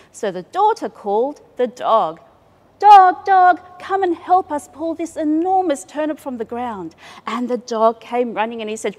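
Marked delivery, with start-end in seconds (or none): 2.8-7.0 loud, high call to the dog. A narrator's reading. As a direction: shout for the dog. Daughter calls the dog.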